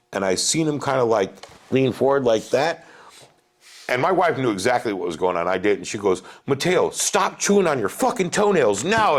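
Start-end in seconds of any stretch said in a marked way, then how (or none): 6.5-9.2 put-on higher-pitched exasperated mom telling off her kid; mock scolding. Wife to son chewing his toenails, mock scolding. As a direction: imitate a mother's sharp order, pitched up.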